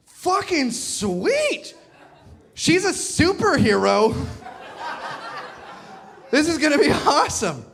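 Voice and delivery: giddy high-pitched delight; slow, high, thrilled gush; excited. Retold or imitated. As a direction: squeal it like a kid in a toy store. Excited discovering closet.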